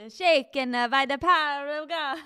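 playful preacher impression; mock preacher echo. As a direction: boom it back like a tent-meeting preacher. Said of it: "I'm translating here".

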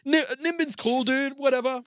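high, bored brush-off voice; mock dismissive. Brushing off the friendly Swede, high and dismissive.

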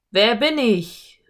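slow, loud, drawn-out call. A narrator's reading. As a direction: call into the canyon to raise an echo. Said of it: "rief er mit lauter Stimme".

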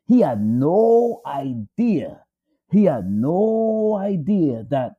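very slow, weighted repetition; slow emphasis. Shown as a demonstration. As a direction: drive home that he had no idea. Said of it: "repeated for emphasis".

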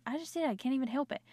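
ordinary delivery

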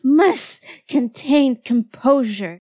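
very high squeal, then strained control; high-pitched comic agony easing into a halting effort at calm; shrieking in pain. A narrator's reading. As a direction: yelp as if scalded, then fight for composure. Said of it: "Tamaki shrieked".

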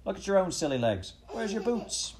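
high, jeering Cockney retort; mock him right back, voice climbing; acted street heckle; bickering street man. A narrator's reading.

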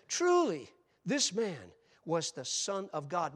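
loud, ringing declaration of the centurion; shouted proclamation from the foot of the cross; exclaimed. Acted. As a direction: cry it out with awe, slowing at the end. Preacher voicing the centurion's cry.

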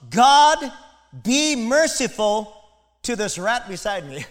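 high, loud, slow plea that turns sly; hammed-up cry for mercy with a joke at the end; pleading cry to God. Tax collector cries out to God, loud and pleading. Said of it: "And he goes".

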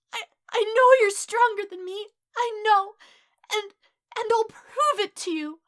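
high, loud stammering plea; desperate, tearful outburst. A narrator's reading. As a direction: insist through tears, voice pitched high. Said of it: "eyes blurred with tears".

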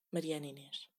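muttered name, almost voiceless; resigned mutter. Real, not acted. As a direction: sigh the name to yourself, defeated. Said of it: "acabei de dizer o meu segundo nome".

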